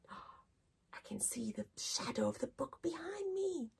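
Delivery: hushed, wonder-struck whisper. Acted. Teacher whispering to children about the shadow. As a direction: whisper it like a secret discovery.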